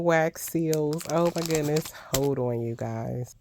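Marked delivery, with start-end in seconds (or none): none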